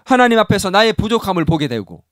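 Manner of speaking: ordinary talk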